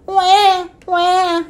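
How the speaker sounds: high, imitated wail; baby seal cry. A narrator's reading. Baby seal heard from the beach, crying. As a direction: bleat like a crying seal pup. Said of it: "she heard a cry".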